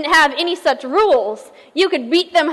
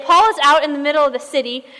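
normal talking voice

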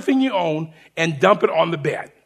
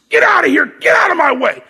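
higher and louder, barking orders; retold drill sergeant shout; 9 semitones higher, 5 dB louder than usual. Sergeant shoving through recruits, imitated drill shout. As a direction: bellow at the troops to clear the way.

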